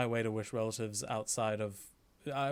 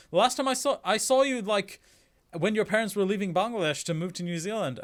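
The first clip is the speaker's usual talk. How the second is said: higher, louder indignant uncle; offended relative; 7 semitones higher, 6 dB louder than usual. Retold or imitated. Uncle in Bangladesh reproaching him, raised and indignant.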